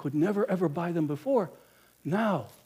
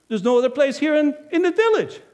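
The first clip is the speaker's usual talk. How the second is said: smug innkeeper; 11 semitones higher, 8 dB louder than usual. Acted. Innkeeper brushing off traveler.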